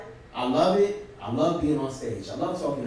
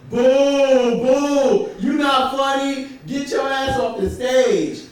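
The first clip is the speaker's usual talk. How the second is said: 1 crowd booing; 8 semitones higher, 6 dB louder than usual. Retold or imitated. Crowd booing him off stage.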